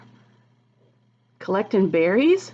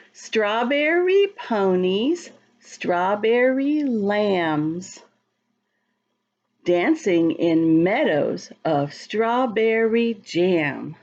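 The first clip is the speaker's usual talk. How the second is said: slow, sing-song rhyme reading; reading to children; much slower than usual. Acted. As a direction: read the rhyme slowly to little kids. Reads the rhyming book aloud.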